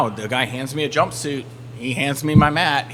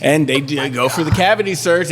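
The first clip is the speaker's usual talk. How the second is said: normal talking voice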